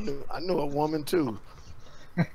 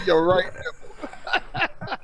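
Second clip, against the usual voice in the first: laughing; 9 semitones higher, much slower than usual. Real, not acted. Laughing while teasing.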